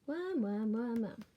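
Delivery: a hint of a greedy mole reading its list; lightly voiced mole. Mole reads out its shopping list, lightly voiced. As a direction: read the list like a hungry little mole. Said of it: "a mole here with a shopping list".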